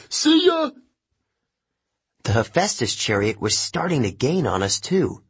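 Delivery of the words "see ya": very high, quick call; high-pitched parting holler; taunting shout. A narrator's reading. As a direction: shout it high to the team behind.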